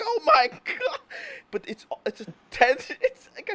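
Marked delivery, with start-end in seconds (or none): 0.0-1.0 high, loud mock amazement; a burst of imitated disbelief; amazed exclamation. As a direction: squeal it like you can't believe it. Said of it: "I'm like".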